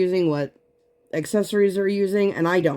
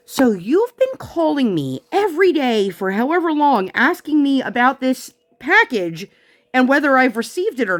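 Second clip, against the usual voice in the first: exasperated; 8 semitones higher, 3 dB louder than usual. Real, not acted. Exasperated rant at mom.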